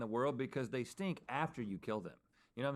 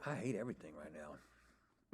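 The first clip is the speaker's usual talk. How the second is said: low, slow miserable groan; despairing; 9 semitones lower, 6 dB quieter, slower than usual. Real, not acted. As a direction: sink into defeated misery. After eating stink bugs.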